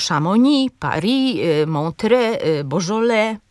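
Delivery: "Chamonix, Paris, Montreux, Beaujolais" each stressed on the last syllable; French final stress. Shown as a demonstration. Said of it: "z akcentem na ostatniej, y, sylabie". („Chamonix, Paris, Montreux, Beaujolais” z akcentem na ostatniej sylabie)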